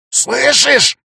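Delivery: high menacing snarl; sinister hiss. Acted. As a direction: threaten him. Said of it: "зашипел зловещим голосом". (зловещее шипение)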